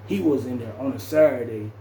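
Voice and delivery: normal talking voice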